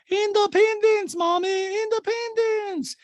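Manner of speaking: put-on very high, slow, loud child-like squeal; Diana. Podcaster mimicking Diana to her mommy figure, hammed-up childish squeal. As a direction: stretch it like a proud toddler. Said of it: "Diana's holding up her ski poles all by herself".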